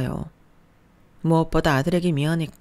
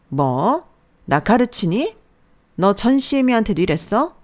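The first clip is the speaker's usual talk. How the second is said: higher, louder indignant retort; mother-in-law rounding on her daughter-in-law, offended and louder; 6 semitones higher, 6 dB louder than usual. Acted. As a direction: bristle at being lectured. Mother-in-law snaps back offended.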